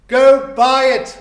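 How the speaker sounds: loud, slow urging; eager command. Acted. Scrooge sending the boy to buy the turkey, loud and eager. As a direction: urge him off with glee.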